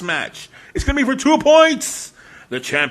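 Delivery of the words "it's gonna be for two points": hype announcer call. Acted. Hyping the match stipulation.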